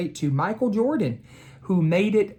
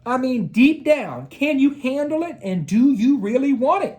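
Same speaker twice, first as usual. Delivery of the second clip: coach voice; 8 semitones higher than usual. A narrator's reading. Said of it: "Coach said".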